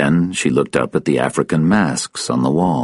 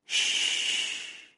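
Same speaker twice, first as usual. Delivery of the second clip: very soft, voiceless shush; secretive shushing; whispered hush; 15 dB quieter than usual. Acted. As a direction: hush someone in a long whisper. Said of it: "and whispered".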